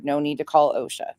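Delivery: ordinary talk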